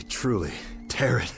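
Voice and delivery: words breaking into breathy laughter; bitter laughter. Acted. Stranded captain musing he might be dreaming, breaking into laughter. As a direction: laugh helplessly at your own predicament. Laughs at his own predicament.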